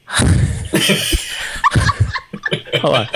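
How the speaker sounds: ordinary talk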